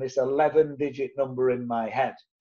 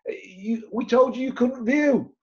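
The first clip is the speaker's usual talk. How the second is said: higher, mock-indignant scolding; imitating cross agents; 8 semitones higher than usual. Estate agents scolding the buyer who viewed anyway, higher mock outrage. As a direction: play the agents' huffy outrage at being bypassed. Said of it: "and they are cross".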